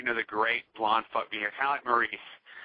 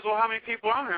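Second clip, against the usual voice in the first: high-pitched mock feminine question; imitated woman; 10 semitones higher, faster than usual. Woman asks about their website, imitated high voice.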